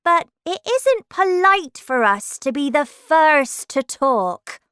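high, loud flower voice; prim storybook rose, correcting the others; talking rose. Acted.

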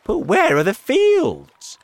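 high, loud, drawn-out question; alarmed cry. A narrator's reading. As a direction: cry out in bewilderment. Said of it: "they cried".

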